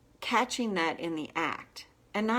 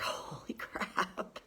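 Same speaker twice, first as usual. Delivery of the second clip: laughing whisper; 8 dB quieter, much slower than usual. Retold or imitated. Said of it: "I'm like".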